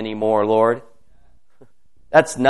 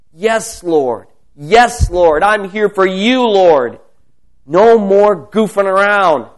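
higher, louder fervent yes to the Lord; fervent prayer; 6 semitones higher, 6 dB louder than usual. Real, not acted. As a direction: commit with rising fervour. Fervent affirmation.

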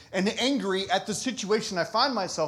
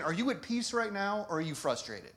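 softer, calm, questioning gently; gentle voice of the Lord; 8 dB quieter than usual. Retold or imitated. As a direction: ask it softly like a caring father. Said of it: "the Lord's saying".

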